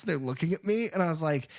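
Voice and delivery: normal talking voice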